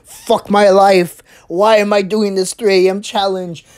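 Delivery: ordinary talk, nothing marked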